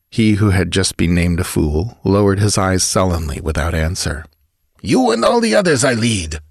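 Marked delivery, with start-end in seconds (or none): 4.8-6.4 high-pitched bandit chief's rebuke; snarling. A narrator's reading. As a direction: snarl it at your whole gang. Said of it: "words came in a cold snarl".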